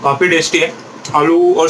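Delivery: speaking with food in mouth; talking while chewing. Real, not acted. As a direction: mouth partly full. Seen 0: bite at -7, chewing continues; pursed, full-looking mouth while speaking early.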